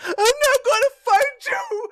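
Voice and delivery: mock his panicked cry; high squeaky mock yell; mocking mimic.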